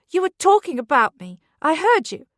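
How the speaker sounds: high, loud accusing yell; angry shout. Acted. Beth accuses Charlie.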